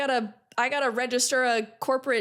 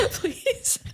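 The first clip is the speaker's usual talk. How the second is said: laughing; 3 semitones higher than usual. Real, not acted. Laughing pleading.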